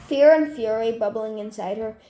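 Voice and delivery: usual speaking voice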